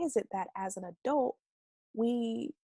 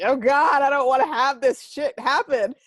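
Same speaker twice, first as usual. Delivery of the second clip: louder, higher exasperated outburst; exasperated groan; 5 semitones higher, 10 dB louder than usual. Pretend. Someone dreading life's mess, voiced loud and exasperated. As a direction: whine it like you can't take another bad thing. Acted dread of bad events.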